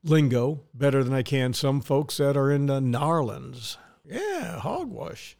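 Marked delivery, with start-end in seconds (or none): 4.0-5.4 mock country-folk accent, pitched up; rural drawl. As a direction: play a backwoods local scoffing. Mimics rural Narlands folk.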